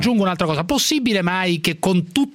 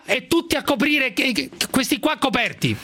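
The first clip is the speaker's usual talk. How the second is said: showy game show host; 9 semitones higher than usual. Pretend. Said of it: "Fammi fare il conduttore radiofonico".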